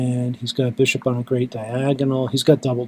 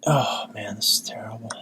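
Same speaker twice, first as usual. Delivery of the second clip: hopeless whisper under his breath; 4 dB quieter than usual. Real, not acted. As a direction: let it out as a defeated whisper. Muttered despair at losing.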